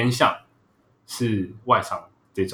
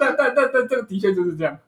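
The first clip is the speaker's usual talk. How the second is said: laughing; 10 semitones higher than usual. Real, not acted. Laughs over awkward point.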